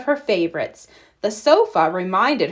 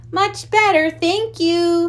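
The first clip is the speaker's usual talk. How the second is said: put-on high young cub voice; 9 semitones higher, slower than usual.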